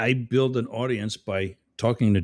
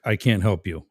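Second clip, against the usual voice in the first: ordinary delivery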